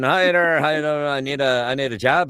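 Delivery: high, loud, eager Newfoundlander; excitable Newfie job-seeker accent. Acted. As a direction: hail the boss in a Newfoundland accent. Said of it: "jump the pond over to Newfoundland".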